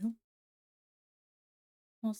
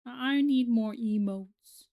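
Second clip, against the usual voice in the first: slow drawn-out plea; 6 dB louder, slower than usual. Real, not acted. Pleading for emotes.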